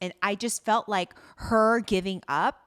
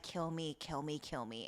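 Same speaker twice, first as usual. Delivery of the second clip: much softer, lower, drained kill me; defeated whisper; 7 semitones lower, 13 dB quieter than usual. Retold or imitated. Recapping the choked heroine giving up, faint and defeated. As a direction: let it fade to nothing.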